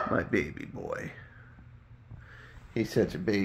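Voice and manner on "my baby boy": soft, breathy, lower murmur to a pet; tender coo. Real, not acted. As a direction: whisper it lovingly to the cat. Cooing softly to her cat.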